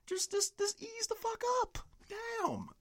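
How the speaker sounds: very high squeaky comic voice; mock squeaky plea. Ranting host telling people to ease up, cartoon squeak.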